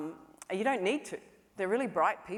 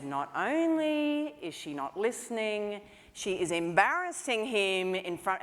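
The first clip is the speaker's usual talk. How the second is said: slow, emphatic, rising indignation; getting angrier; 3 semitones higher, slower than usual. Retold or imitated. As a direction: build outrage on the child's behalf. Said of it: "I started getting angry and angry".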